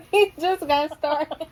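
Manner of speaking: high, loud, slow through laughter; helpless laughing delivery. Real, not acted. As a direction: crack up while talking. Cracking up during a blooper.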